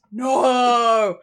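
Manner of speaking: long, loud, high mock-anguished wail; dramatic drawn-out protest; horrified cry. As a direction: cry out in hammed-up horror. Horrified reaction to the prequel pitch.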